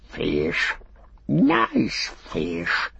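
drawn-out hissing creature voice; Gollum, gloating creature. A narrator's reading. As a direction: stretch and hiss the words greedily. Gollum's voice gloating over fish.

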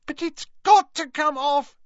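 high squeaky protest. Acted. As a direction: squeak it out in distress. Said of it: "squeaked Hermione".

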